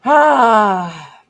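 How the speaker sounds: ordinary talk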